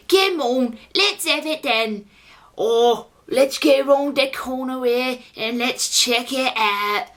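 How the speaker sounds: exaggerated irritating Cockney pig creature; mock Cockney accent. Film's pig creature spoiling for a fight, mock Cockney. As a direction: put on a drawn-out, overdone Cockney. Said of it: "irritating Cockney accent".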